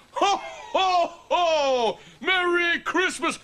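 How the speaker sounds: loud, high-pitched festive greeting; Father Christmas. Pretend. [name] resuming the disguise, jolly Santa voice. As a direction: boom ho-ho-ho with slow relish. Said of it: "pretending to be Father Christmas".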